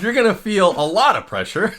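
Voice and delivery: laughing pretend warning; laughing mock doctor. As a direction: announce it like a doctor, cracking up. Joking as big-handed gynecologist, laughing.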